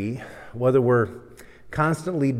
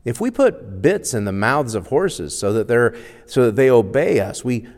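loud, emphatic Bible reading with raised pitch; scripture reading; 3 semitones higher, 6 dB louder than usual. Acted. Preacher reading James chapter three aloud, loud and emphatic. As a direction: proclaim the passage, driving the key words.

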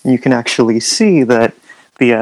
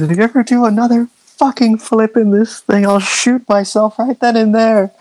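high-pitched hollered threat; furious navigator, acted high; screaming navigator; 10 semitones higher than usual. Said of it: "She's just screaming and hollering".

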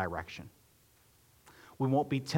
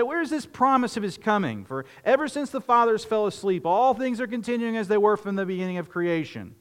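higher, louder mock-scoffing voice; mocking scoffer; 10 semitones higher, 5 dB louder than usual. Preacher mimics the scoffers to his congregation, mock scoffing and higher. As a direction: sneer it like a doubting scoffer. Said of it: "Now, say".

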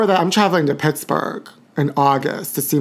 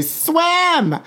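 high barked command; mother yelling swim; 12 semitones higher than usual. Retold or imitated. Mother ordering daughter into pool, sharp high command. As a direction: imitate a pushy mom ordering her kid. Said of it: "and say".

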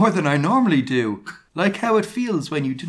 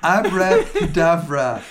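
slow, drawn-out spell cry; mild yell; 3 semitones higher, 5 dB louder than usual. A narrator's reading. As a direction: call out the spell slowly. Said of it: "he yelled at Snape".

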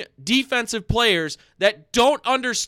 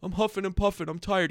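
softer, whiny mock of a winded player; mocking Myles Garrett; 6 dB quieter than usual. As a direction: sneer at him as a lazy star. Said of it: "And Myles Garrett".

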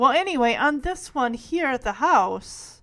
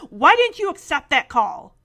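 louder, higher, demanding; angry sister; 5 semitones higher, 7 dB louder than usual. Retold or imitated. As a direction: snap the question at your sibling. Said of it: "my sister was angry when she got home".

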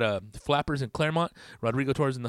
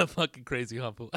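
usual speaking voice

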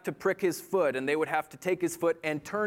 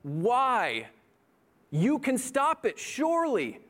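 pleading; 7 semitones higher, slower than usual. Acted. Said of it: "I'm the one who looks at God and says".

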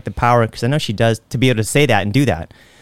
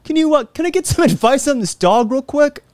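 much higher, playful voice; mock bro asking; 14 semitones higher than usual. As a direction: ham up a friendly ask. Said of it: "I'm going like".

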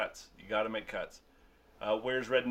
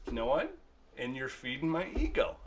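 normal talking voice

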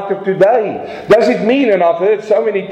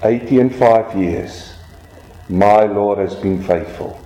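faithful last words; 9 semitones lower than usual. Retold or imitated. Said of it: "He said these words, he said".

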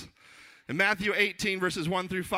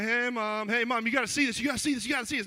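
higher, eager repeated plea; urgent excitement; 7 semitones higher than usual. Retold or imitated. Son showing mom the baby on FaceTime, urgently excited. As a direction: hurry mom to look right now.

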